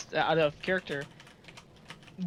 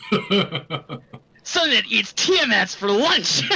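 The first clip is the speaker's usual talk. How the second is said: laughing; 6 dB louder, much slower than usual. Acted. Laughing mock exclamation.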